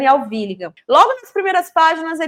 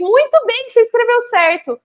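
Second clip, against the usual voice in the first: much higher, gushing praise; mock teacher praise; 10 semitones higher than usual. Teacher praising a child's spelling, mock gushing. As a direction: cheer the child on, pitched way up. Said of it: "Aí ela inverte".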